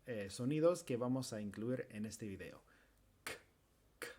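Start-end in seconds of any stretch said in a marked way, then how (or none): 3.2-4.1 "K" solo aire desde atrás de la boca; k sorda. Shown as a demonstration. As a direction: suelta la k sin voz. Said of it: "el sonido sordo". (k sorda aislada)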